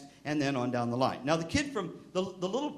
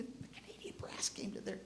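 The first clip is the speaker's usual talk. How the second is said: hushed, higher-pitched near-whisper; whispered aside; 7 semitones higher, 13 dB quieter than usual. Real, not acted. Speaker confides who else was invited along, whispered. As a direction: drop to an awed stage whisper.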